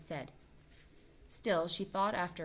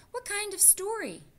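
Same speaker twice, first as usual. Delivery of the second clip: much higher, eager little mouse voice; eager child voice; 3 dB louder than usual. Acted. Cynthia eagerly asks her mother about the story. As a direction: ask like an impatient little girl.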